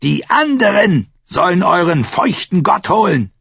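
high, loud scornful cry; shouted curse. A narrator's reading. Captive curses the priest's drowned god, shouting. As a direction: hurl it with defiant fury.

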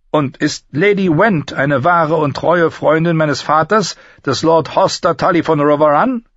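high, clear-voiced lady addressing a knight; composed noble lady. Lady asks an older man about his crest, high and formal.

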